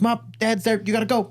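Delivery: put-on high, panicky kid warning; retold alarm, pitch jumping up; urgent warning. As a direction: warn mom in a hurry. Retold kid warns mom urgently.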